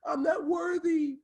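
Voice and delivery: hammed-up high voice of surrender; bow down to them in a slow, high mock voice; awestruck worshipper. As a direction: draw it out high, mock-reverent. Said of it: "fall to their knees and say".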